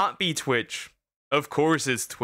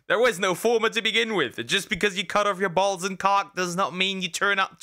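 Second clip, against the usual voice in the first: usual speaking voice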